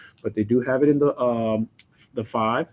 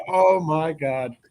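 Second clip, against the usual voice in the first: ordinary talk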